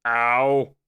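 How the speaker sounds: loud pained ow; pained yelp. Real, not acted. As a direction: yelp out in real pain. Hurt by loud bell.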